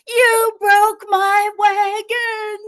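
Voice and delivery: put-on high, slow, loud childish scream; bratty kid shrieking over his broken wagon; screaming boy. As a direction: scream it like a furious little boy. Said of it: "he starts to scream".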